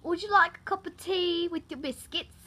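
British accent. Shown as a demonstration. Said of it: "British accent".

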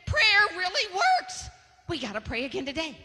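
put-on a child's eager cry; excited kid. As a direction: announce it like a thrilled little girl. Child voice of [name].

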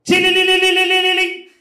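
loud electronic trill; intercom ring. Retold or imitated. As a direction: mimic an intercom ringing.

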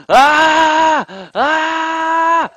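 high loud real screams of fright; startled screams. Real, not acted. Player surprised by a creature in the jungle, real startled screams. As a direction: yelp twice as something jumps out.

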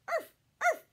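two high, loud yaps; dog bark. Shown as a demonstration. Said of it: "What does he say?".

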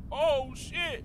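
startled yelp. Real, not acted. Gamer jolted by a jump scare, startled yelp. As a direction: caught off guard, blurt it out.

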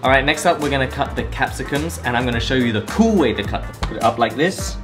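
normal talking voice

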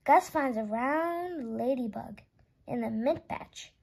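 ordinary delivery

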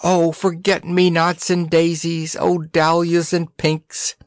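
lilting, slow and high; dreamy chant of flowers; sing-song flower girl. A narrator's reading. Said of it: "danced happily".

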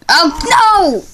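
high, drawn-out falling no; dismayed cry. Real, not acted. Player reacting to a mishap in the game, dismayed cry. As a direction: cry out as it goes wrong.

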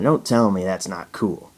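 ordinary talk

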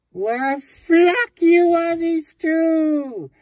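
very loud, high, drawn-out scream; furious yell. Acted. Insulted guest screams at the Aussies, furious. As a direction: hurl it at them with everything.

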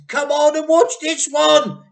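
high, loud, slow call across the yard. A narrator's reading. Mr Cranky calling his wife to watch, excited call. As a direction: call her over, thrilled. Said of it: "he called out to Missus Cranky".

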